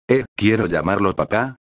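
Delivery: usual speaking voice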